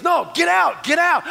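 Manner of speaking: high-pitched, insistent mock command; comic counter-order, pitched well up; urgent ordering out. Voices Jesus countering the minister.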